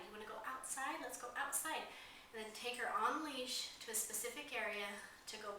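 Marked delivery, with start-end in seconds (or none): 0.1-1.8 "outside" lifted and stretched, softer coaxing voice; stress on outside. Shown as a demonstration. Trainer asking the puppy out, stress on "outside". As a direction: lean on "outside" each time, pitched up. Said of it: "emphasize the word outside".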